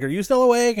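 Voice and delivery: high, hammed-up calling to a friend; mock-call up to the window, high-pitched. Nancy calling Glenn in retelling.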